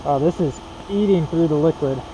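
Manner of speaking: muffled behind a full face mask; talking through a mask. Real, not acted. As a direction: voice dulled by a painting mask. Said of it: "I'm talking through a mask".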